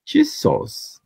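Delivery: high amused disbelief; amused exclamation. Real, not acted. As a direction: gasp it out in disbelief. Exclaims at the comment.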